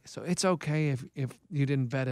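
low, soft, deadpan coach impression; Keith. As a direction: imitate an older friend's low, quiet phone voice. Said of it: "he's like".

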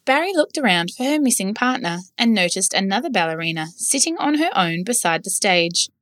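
usual speaking voice

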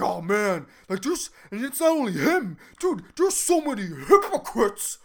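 mock-indignant, over-enunciated complaint; worked up, angry. Student griping about her university, imitated with exaggerated outrage. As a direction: imitate an exasperated student's rant. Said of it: "And she's like".

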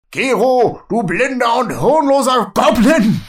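laut und hoch quer durch den Raum gebrüllt; wütender Ruf. Acted. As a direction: beschimpf ihn über die Distanz hinweg. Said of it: "Er blickt zu dir rüber". (Urn brüllt seinen Vetter Gero wütend an)

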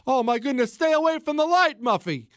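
high-pitched plea to a dying pet; hammed-up, high cry of alarm; mock panicked onlooker. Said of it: "where you're like".

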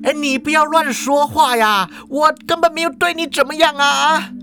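high, panicky protest; alarmed and pleading in a high voice; flustered denial. Acted. Principal frantically denies it.

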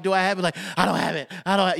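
high, anxious muttering. Retold or imitated.

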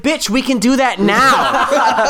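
high, slow, laughing indignation; mock exasperated retort. Child answering dad's offer to hang out, mock exasperation. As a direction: spell it out in comic outrage.

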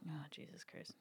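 breathy whisper of dread. Real, not acted. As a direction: whisper it under your breath. Dreads the hot sauce she is facing.

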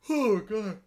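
words stretched by a yawn; mid-yawn groan. Real, not acted. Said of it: "Sorry for yawning so much".

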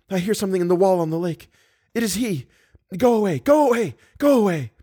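high-pitched, urgent pleading; panicked warning. A narrator's reading. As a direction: warn them away in a high, frightened voice. Captive warns as captor approaches.

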